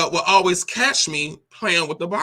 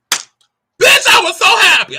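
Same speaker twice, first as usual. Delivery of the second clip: much higher, louder burst of joy; overjoyed exclamation; 13 semitones higher, 7 dB louder, slower than usual. Real, not acted. Tells viewers about the landlord's daughters, joyful shriek. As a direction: shriek it with delight. Recalling moving near girls.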